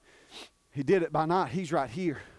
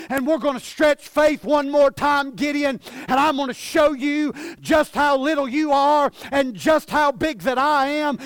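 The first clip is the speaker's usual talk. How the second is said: raised, driving pulpit delivery; forceful preaching; 6 semitones higher, 5 dB louder than usual. Real, not acted. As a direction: build the sermon to a ringing high point.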